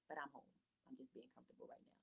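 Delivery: fast, soft whispered "I'm just being comfortable"; whispered aside. Real, not acted. Teacher mumbling an aside to parents about sitting at home. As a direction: toss it off under your breath. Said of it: "aside about being at home".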